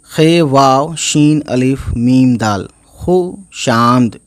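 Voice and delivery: ordinary talk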